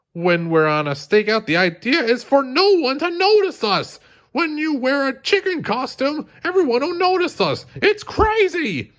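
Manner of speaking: very high, strained scolding, slowing at the end; exasperated Bob. Acted. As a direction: wind up into a desperate lecture. Bob lecturing Larry, exasperated.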